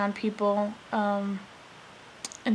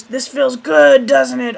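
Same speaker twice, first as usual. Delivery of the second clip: louder, slow and higher, pushing it on her; hearty coaxing grandpa; 3 semitones higher, 10 dB louder, slower than usual. Retold or imitated. Grandpa to injured granddaughter, loud hearty coaxing. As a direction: boom it out like a cheerful old man sure he's helping. Grandpa rubbing juice on broken thumb.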